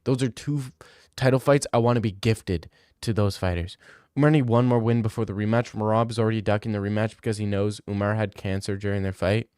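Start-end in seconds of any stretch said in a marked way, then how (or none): none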